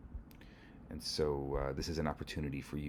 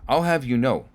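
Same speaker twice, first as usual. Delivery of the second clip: higher, louder officious anger; indignant outburst; 7 semitones higher, 8 dB louder than usual. Acted. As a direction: pull rank furiously. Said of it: "that sets the inspector off".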